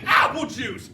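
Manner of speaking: imitating a kid's high yell; hammed-up high toddler demand. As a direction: cry out high like an insistent child. Imitates son demanding juice.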